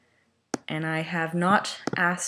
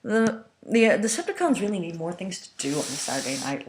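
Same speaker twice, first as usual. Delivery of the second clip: usual speaking voice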